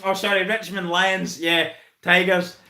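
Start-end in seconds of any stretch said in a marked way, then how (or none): none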